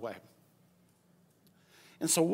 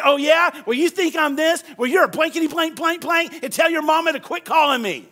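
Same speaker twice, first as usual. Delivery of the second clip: higher, louder mock retort; mock comeback; 7 semitones higher, 5 dB louder than usual. Preacher imitating someone hitting back at critics, mock anger. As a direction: fire back like a hothead. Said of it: "And you say".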